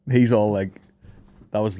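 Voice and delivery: ordinary delivery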